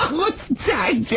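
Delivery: asked through high laughter; words squeezed out mid-laugh. Real, not acted. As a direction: barely get the question out for laughing. Still laughing at Carl's clue.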